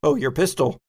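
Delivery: ordinary talk, nothing marked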